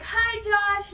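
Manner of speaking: excited greeting. Retold or imitated. Fans greeting the drummer.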